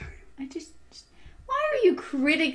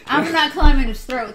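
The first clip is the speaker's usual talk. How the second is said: louder, laughing through it; 8 dB louder than usual. Real, not acted. Laughing refusal.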